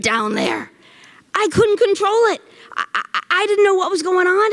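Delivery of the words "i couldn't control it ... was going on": higher, helpless protest; flustered distress. Acted. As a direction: stammer it out in embarrassed panic. Recalls the embarrassing flood, flustered.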